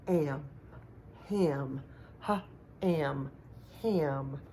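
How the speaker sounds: "ham" built from "am" with an added h breath; sounding out ham. Shown as a demonstration. Teacher sounding out ham from am. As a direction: segment "ha" then "am" slowly. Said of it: "That's our second word".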